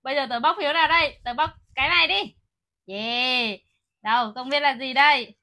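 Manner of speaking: usual speaking voice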